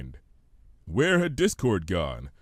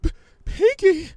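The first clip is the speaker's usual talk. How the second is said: high, loud stuttering call; terrified cry; 7 dB louder than usual. Acted. Fluttershy crying out to Pinkie, loud and panicked.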